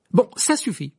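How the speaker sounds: loud, high, sharp cut-off; curt. Acted. Harry with wand drawn to the twins, curt. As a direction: end the argument dryly. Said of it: "dit-il sèchement".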